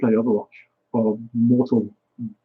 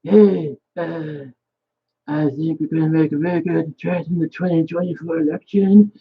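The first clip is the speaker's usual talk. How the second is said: higher, drawn-out put-on Trump voice with slow bragging promises; Trump impression; 4 semitones higher than usual. Vlogger mocking Trump boasting about Florida votes, put-on Trump voice. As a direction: puff up and boast like Trump at a rally. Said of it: "That's my best Trump impression".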